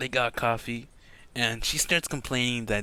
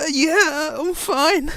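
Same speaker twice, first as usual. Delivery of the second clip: very high, loud, cracking laughter around the words; stressed false cheer; 9 dB louder, much slower than usual. Acted. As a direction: insist you're fine while falling apart. Stressed character insists he's fine.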